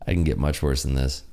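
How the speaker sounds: normal talking voice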